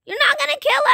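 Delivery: high, fast frantic plea; panicked pleading. Real, not acted. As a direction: beg them not to kill him. Begging a player not to kill.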